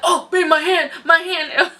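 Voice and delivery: high mock whine of a husband whose hand is crushed; mock pained husband. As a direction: play up the husband's squeezed-hand whimper. Husband's hand being squeezed.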